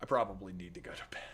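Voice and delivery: fast, soft whispered aside. Real, not acted. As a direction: whisper it quickly to yourself.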